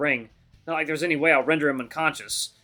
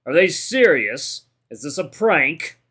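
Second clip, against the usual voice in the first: loud, slow disbelief; big fighter scoffing at a prank; incredulous fighter's outburst; 6 dB louder, much slower than usual. A narrator's reading. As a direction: draw it out, as if mocking the whole setup. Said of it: "how sudden his outburst was".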